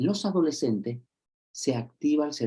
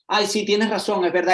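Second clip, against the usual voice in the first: higher, louder mock conceding; placating partner; 8 semitones higher, 5 dB louder than usual. A partner mock-conceding during an argument, higher and louder. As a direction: give in sing-song to calm things down. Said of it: "tu pareja te dice".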